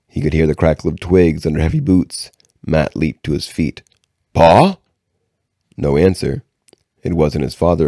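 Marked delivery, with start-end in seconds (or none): 4.3-4.8 calling out hopefully. Acted. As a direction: call toward the trees. Boy calls out to the woods.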